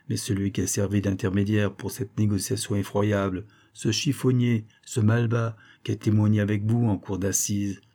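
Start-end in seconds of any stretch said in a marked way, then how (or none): none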